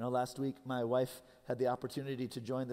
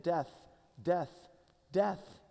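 each DEATH pitched higher than usual, climbing; 4 semitones higher, much slower than usual. Retold or imitated. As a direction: punch out each word, rising to the last. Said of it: "higher and higher in pitch".

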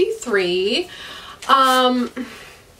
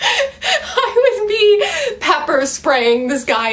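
laughing; 9 semitones higher, slower than usual. Real, not acted. Laughing rant about creepy love interest.

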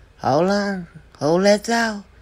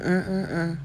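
ordinary talk, nothing marked

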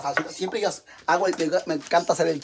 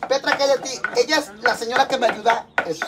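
ordinary talk, nothing marked

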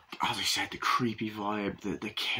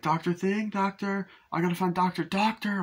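high, searching call; lightly acted nurse calling, pitched well up; imitated calling out; 10 semitones higher than usual. Retelling the nurse hunting for the doctor, high mock calling.

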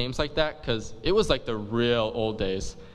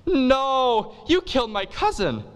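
much higher, loud hammed-up wail on no; anguished cry; 12 semitones higher, 4 dB louder, slower than usual. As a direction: cry out in mock grief. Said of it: "Romeo's like".

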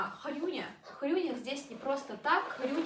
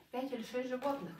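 normal talking voice

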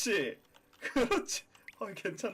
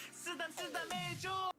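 put-on high, soft baby-talk plea; cutesy voice; 14 semitones higher, 8 dB quieter, slower than usual. Streamer cooing to viewers to pet the puppy. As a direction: coo it like a baby.

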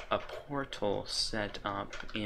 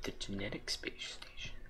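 whisper; 9 dB quieter than usual. Real, not acted. Whispered to himself.

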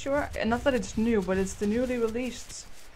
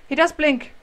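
much higher, much louder exclaim; surprised; 7 semitones higher, 11 dB louder than usual. Real, not acted. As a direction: blurt out the discovery. Surprised discovery.

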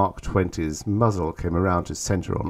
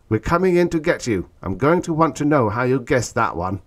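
high, urgent voice over the link; urgent hiss; 6 semitones higher, 5 dB louder than usual. A narrator's reading. Stetson promising Orn backup, urgent hiss. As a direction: press it on him, high-pitched. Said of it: "hissed Stetson".

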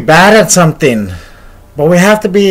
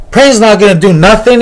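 higher, scornful bystander voice; scoffing dismissal; 5 semitones higher, 4 dB louder than usual. Retold or imitated. Scoffing man dismisses prayer.

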